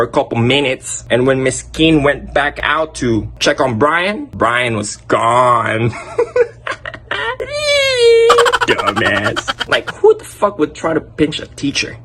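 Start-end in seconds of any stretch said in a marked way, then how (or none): 5.9-8.6 high, wheezing real laugh; squealing real laughter; burst of laughter. Real, not acted. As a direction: crack up at the story. Laughing at Brian running off.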